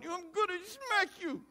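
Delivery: high, squeaky mock threat; a hint of annoyed smack talk. Annoyed listener pretending to threaten the cheerful person. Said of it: "And you're going".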